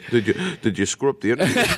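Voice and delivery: fast, amused accusing question; laughing. Retold or imitated. Comedian catching the interviewer out, amused. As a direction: laugh as you catch him out. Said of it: "He starts laughing".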